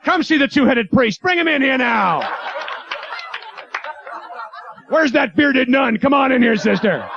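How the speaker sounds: high-pitched hawker's call; carnival barker. Acted. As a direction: call the crowd in like a sideshow barker. Carnival barker hawking church freak show.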